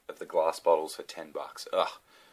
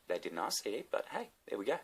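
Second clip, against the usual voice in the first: ordinary talk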